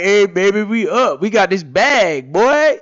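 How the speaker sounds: loud, high hype-man bragging; bragging rich guy. Pretend. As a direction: show off to your girl, loud and high. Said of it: "You could be sitting over there like".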